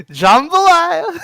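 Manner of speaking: drawn-out, high, loud outburst; silly slow whoop on one word; mock exclamation. Showing off words in put-on accent.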